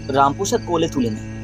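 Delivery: usual speaking voice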